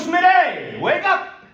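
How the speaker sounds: high, booming wake-up yell; loud shout. Retold or imitated. Best friend bursts in to wake him, shouting. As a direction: blast him awake like a boisterous friend. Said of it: "he's a very boisterous and loud man".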